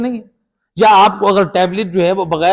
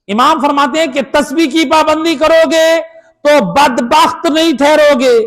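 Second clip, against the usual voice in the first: fervent preaching; 11 semitones higher, 5 dB louder than usual. Real, not acted. Preaching at full force.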